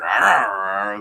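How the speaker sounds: growling like a wolf, loud; playful wolf growl at the pack. Retold or imitated. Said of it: "she's like".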